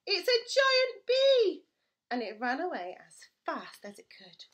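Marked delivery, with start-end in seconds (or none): 0.1-1.6 high, loud, slow terrified shout; frightened bear. Acted. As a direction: bear scared of the giant bee.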